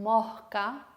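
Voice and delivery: "mosca" with the s as an h before the k sound; aspirated S. Shown as a demonstration. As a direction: breathe out the s in "mosca". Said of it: "sino mosca".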